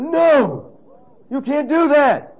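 high, loud, drawn-out protest; mock outraged outcry. Everybody reacting to the Reformation, mock scandalised outcry. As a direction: cry out in hammed-up horror.

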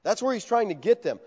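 ordinary talk, nothing marked

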